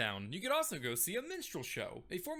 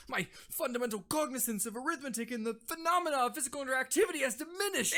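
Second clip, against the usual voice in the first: fast jargon rattle; 4 semitones higher, faster than usual. Acted. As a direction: rattle off the big words fast. Doc's jargon.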